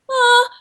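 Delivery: a high, loud aww; touched aww. Retold or imitated. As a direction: melt with sympathy. Imitating emotional reactions.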